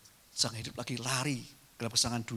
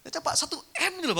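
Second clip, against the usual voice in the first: excited; 6 dB louder than usual. Acted. Stressing a billion rupiah to congregation.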